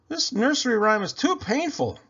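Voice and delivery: high complaining voice. Acted. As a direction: whine that it hurts. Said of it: "complained little Miss Muffet".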